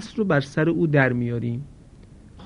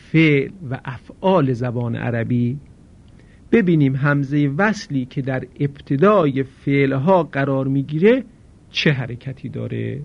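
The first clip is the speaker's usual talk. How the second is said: usual speaking voice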